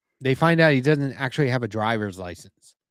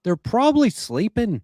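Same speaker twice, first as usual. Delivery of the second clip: a hammed-up, deliberately bad Irish accent, pitched higher; 6 semitones higher, faster than usual. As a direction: play a leprechaun in the worst Irish accent you can manage. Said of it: "That's the worst Irish accent".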